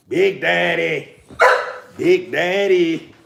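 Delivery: slow, stretched Big Daddy to the dog; drawn-out affectionate call to the dog; slow drawn-out pet name. Real, not acted. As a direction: croon it out slowly like a doggy nickname.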